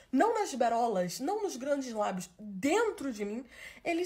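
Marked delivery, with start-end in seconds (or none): none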